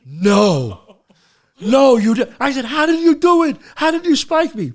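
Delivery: loud, high mock protest; retold outrage. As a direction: cry out in exaggerated disbelief. Retold outrage at being spiked.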